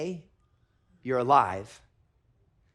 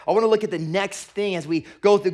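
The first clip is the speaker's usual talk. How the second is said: loud, raised preacher voice; preaching at full force; 5 semitones higher, 8 dB louder than usual. Acted. Pastor proclaiming loudly to the church. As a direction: drive the point home to the room.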